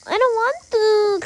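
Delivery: high, loud pretend whine; childish protest. Child told to leave the bathtub, whining in protest. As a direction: protest like a kid refusing mom.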